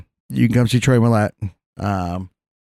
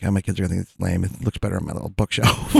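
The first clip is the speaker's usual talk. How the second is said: quiet mutter; 6 semitones lower, 7 dB quieter than usual. Retold or imitated. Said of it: "and I'm like".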